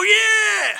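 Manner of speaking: high, loud cheering crowd. Retold or imitated. Crowd answers the tightrope walker with a loud high cheer. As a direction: yell it like an excited crowd. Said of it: "And they go".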